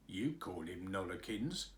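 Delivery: low, soft stolid gardener; stubborn low countryman. A narrator's reading. Gardener stubbornly answering the nurse, low and soft.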